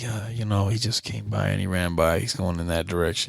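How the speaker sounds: low, soft mock radio voice; unreactive dispatcher. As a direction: imitate an unbothered officer, dropped low and quiet. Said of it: "Like, no reaction?".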